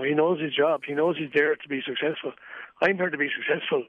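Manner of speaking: ordinary talk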